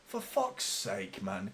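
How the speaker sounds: slow, soft, weary curse; exasperated groan. Real, not acted. Fan groaning at his screen after the goal goes in. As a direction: sag with disgust.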